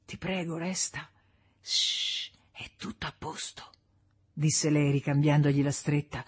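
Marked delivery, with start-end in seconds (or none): 0.0-1.1 soft, hushed begging; whispered plea. A narrator's reading. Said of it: "sussurrò tra le labbra gonfie e screpolate". 1.6-3.7 very soft, whispered reassurance; soothing whisper. A narrator's reading. Woman soothes dreaming husband.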